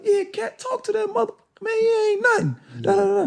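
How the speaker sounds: very high-pitched retold hype; imitating excited courtside trash talk; hyping a teammate. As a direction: squeal encouragement at your scorer. Teammate hyping Cat after scoring.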